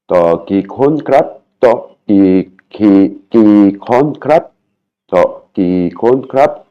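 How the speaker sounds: syllable by syllable, slow; to ki kon krap spelled out slowly in Thai tones; slow Thai phrase. Shown as a demonstration. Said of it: "Une table pour combien de personnes".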